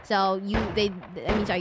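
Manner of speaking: ordinary talk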